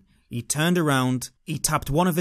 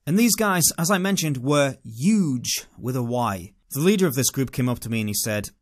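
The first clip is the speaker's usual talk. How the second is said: ordinary delivery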